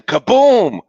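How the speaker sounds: loud, high-swooping kaboom; booming thunder. A narrator's reading. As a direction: boom it out like a thunderclap. Thunder crash in the story.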